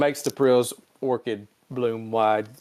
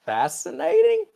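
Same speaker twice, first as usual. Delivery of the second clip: mock fascination; 4 dB louder than usual. Spock answering complaint about her mother, mock fascination. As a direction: stretch it high in feigned interest.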